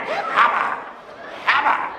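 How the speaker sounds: high strained push noise; straining grunt. Retold or imitated. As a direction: mimic someone straining hard.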